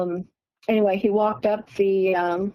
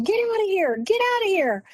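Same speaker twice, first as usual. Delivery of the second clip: high-pitched mock yell, no real volume; retold yelling; 10 semitones higher than usual. Boyfriend retelling how he chased the figures off, mock yelling. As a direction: imitate his yelling, pitched way up but contained. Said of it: "I started yelling at 'em".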